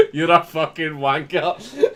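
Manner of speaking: slow, laughing shout of abuse; laughing insult. Real, not acted. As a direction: curse a friend out while cracking up. Mock abuse of co-host over soundbite.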